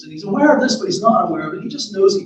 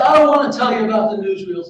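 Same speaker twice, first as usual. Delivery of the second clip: lightly acted refusal, louder and higher; a touch of defiant boy; balking son; 4 semitones higher, 9 dB louder than usual. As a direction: push back at your mother, voice raised. Son balks at mother's questions.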